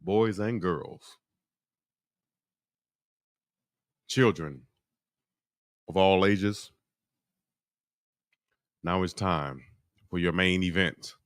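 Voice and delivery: booming ring announcer intro; grand entrance announcement. Shown as a demonstration. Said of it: "if you need some voiceovers".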